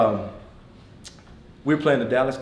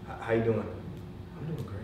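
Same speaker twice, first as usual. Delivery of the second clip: very quiet, low-key leader; Tony Dungy; 3 semitones lower, 7 dB quieter, faster than usual. Retold or imitated. Quiet coach answering a greeting, imitated softly. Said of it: "this is how he talks".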